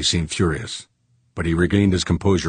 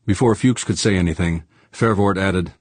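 ordinary delivery

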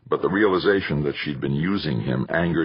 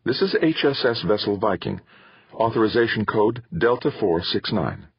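crisp, businesslike radio call; brisk and precise, all business; 5 semitones higher than usual. Acted. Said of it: "and said crisply".

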